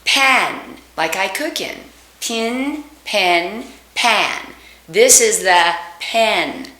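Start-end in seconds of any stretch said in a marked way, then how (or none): 0.0-0.8 "pan" said with the jaw open two fingers wide; wide open short a; open vowel demo. Shown as a demonstration. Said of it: "Two fingers worth". 2.2-4.5 "Pin, pen, pan" with the mouth opening wider each time; careful vowel series; vowel contrast drill. Shown as a demonstration. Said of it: "think of three actual words".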